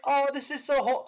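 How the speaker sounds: high, loud gushing reaction; overjoyed squeal of words, hammed-up; gushing mock delight. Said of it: "'cause it was like".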